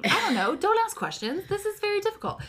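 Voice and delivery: high, flustered mock protest; playfully brushing off the question. Mother waving off her friend's interruption, flustered and high.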